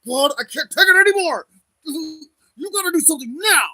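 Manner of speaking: high loud desperate demand; yelling at God. Retold or imitated. In private to God, a high loud frustrated plea. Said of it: "I'll be like".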